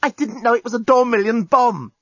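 high-pitched, defensive protest; flustered protest. A narrator's reading. Journalist defends himself to Lucy, flustered. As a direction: protest your innocence in a panic.

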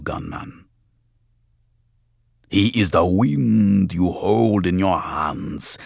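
slow, thick voodoo spirit voice; possessed voice. A narrator's reading. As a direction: speak as a god riding the girl. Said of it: "deep and slow and weirdly glutinous".

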